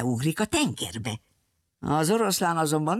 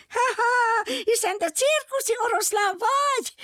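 very high monkey squeal, mocking laugh first; squealing little monkey. A narrator's reading. Little monkey recognises the circus lion, high squeal. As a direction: shriek it like a tiny monkey. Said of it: "visította a majmocska".

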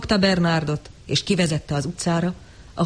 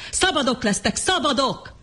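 shouted; 8 semitones higher than usual. Acted. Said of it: "ordította John".

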